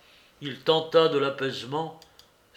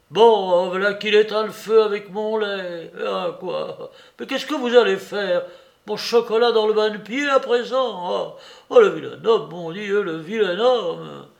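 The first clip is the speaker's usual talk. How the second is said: despairing wail; 6 semitones higher, 7 dB louder than usual. Acted. Soupe despairs over his breakfast.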